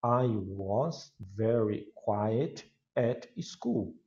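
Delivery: "I was very quiet at school" said very slowly, word by word; teacher modelling pronunciation slowly; English pronunciation demo. Shown as a demonstration. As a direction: stretch each word for the class to copy. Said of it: "mas olha a pronúncia".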